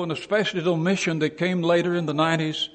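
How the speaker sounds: ordinary talk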